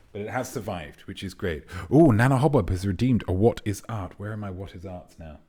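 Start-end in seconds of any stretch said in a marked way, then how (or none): none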